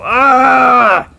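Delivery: high, drawn-out mock scream of frustration; exasperated scream. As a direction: let out a long high "aah", driven up the wall. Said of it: "driving you up the wall".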